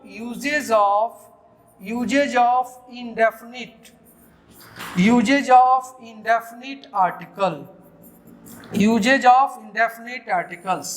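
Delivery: slow emphatic dictation of the title. Shown as a demonstration. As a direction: dictate the heading slowly. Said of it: "dictating the lesson heading".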